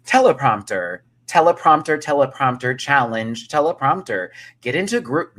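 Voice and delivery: loud, mock challenge call-outs; mock announcer. Mimicking repeated workroom challenge announcements.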